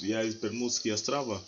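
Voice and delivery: normal talking voice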